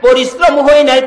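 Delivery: high, loud dramatized mother; Amena protesting. Acted. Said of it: "Amena বলেন".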